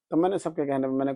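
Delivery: normal talking voice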